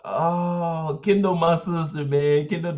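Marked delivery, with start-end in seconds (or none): none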